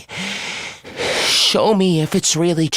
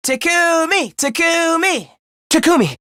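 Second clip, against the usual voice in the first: calling out; 9 semitones higher than usual. Acted. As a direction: call the name high, stretching the middle syllable. Calling out to Takumi.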